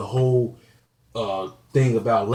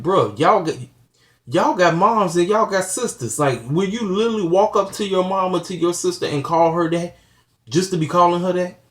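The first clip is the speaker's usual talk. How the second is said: indignant appeal; 6 semitones higher than usual. Retold or imitated. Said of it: "and I just be wondering, like".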